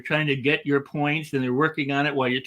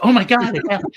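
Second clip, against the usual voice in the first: high, loud "oh my God"; thrilled like a kid at the movie; mock childlike amazement; amazed; 6 semitones higher, 7 dB louder than usual. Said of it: "they just go".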